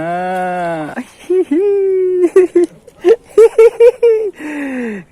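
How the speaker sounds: high, loud shrieking laugh; a loud, high burst of glee; squealing laugh. Real, not acted. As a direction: laugh almost to a cry. Laughing at another catch.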